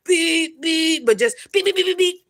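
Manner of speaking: high imitated car horn beeps; horn imitation. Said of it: "rapidly beeping their horn".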